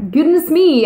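high, loud burst of dismay; overwhelmed reader yelps at her own state; high exclamation. Real, not acted. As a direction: cry out goodness me, way up and loud.

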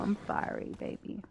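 soft voiceless whisper to her; whispered apology. Real, not acted. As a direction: breathe the apology to your partner. Apology to partner.

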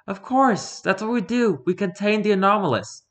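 confident woman hunter, voiced high; Fumiko's voice. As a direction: lift the pitch up for the huntress.

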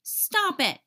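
shout. Acted. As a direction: yell to stop her. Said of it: "shouted Ollie".

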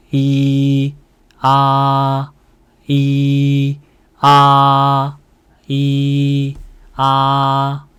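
"이" and "아" held high and perfectly level; first tone. Shown as a demonstration. Teacher drills first tone: "이" "아" high and flat. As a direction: sustain each of "이" and "아" flat on one high note. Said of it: "일성은 평평하게 발성하면 돼요".

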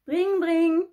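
high, loud telephone "dring dring"; imitated phone ring. Phone interrupts Noël in the story, loud imitated ringing. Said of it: "fit soudain le téléphone".

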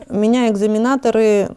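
ordinary talk, nothing marked

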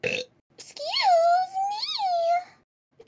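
sudden high squeaky me!; a high cracking squeal on me!; squeak. Real, not acted. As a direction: pop up into a falsetto squeak. Squeaky excuse me after cough.

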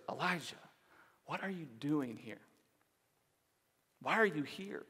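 hushed, very soft questioning; gentle whisper. Acted. God questioning Elijah in the cave, gentle whisper. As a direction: drop right down to a near-whisper. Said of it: "said this again in a whisper".

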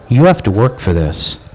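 low, dull mock of a sleepy doctor; lightly voiced lazy dim doctor; mocking dopey doctor. Mocking the sleepy on-call doctor, low and dull. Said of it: "sleepy-eyed mouth breather".

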